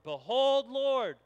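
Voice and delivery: loud, high proclamation of Zacchaeus; loud vow. Acted. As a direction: proclaim it boldly to the Lord. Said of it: "Zacchaeus stood and said to the Lord".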